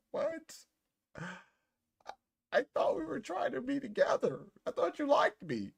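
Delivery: high pleading mock voice, well above his own; whiny hurt guy. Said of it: "you'll be like".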